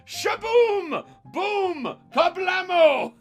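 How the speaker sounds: ordinary talk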